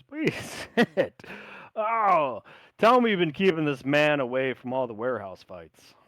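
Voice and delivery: loud, high, laughing invite; old sailor hosting friends; jovial host. Acted. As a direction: welcome them warmly. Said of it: "he goes".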